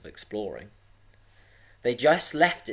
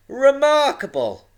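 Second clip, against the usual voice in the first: high, loud cry of wonder; dad's loud, high-pitched exclamation; excited shout; 10 dB louder than usual. A narrator's reading. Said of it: "Oliver's dad had shouted".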